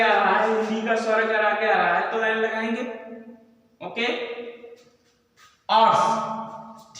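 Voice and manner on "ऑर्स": ऑर्स drawn out very slowly with a silent H, "aw-rs"; pronunciation demo. Shown as a demonstration. Teacher slowly modelling for students the silent-H ऑर्स. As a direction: stretch ऑर्स slowly, vowel first, no H. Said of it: "जैसे इसका pronunciation है".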